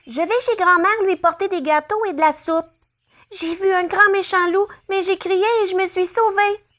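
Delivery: put-on high, breathless little girl; stammering girl. A narrator's reading. Girl tells rabbit her story, stammering high. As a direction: babble it out to the rabbit. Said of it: "bafouille Chaperon Rouge".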